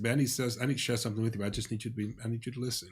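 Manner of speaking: soft, careful request to a partner; gentle request. Retold or imitated. Husband asking his wife to just listen, gentle.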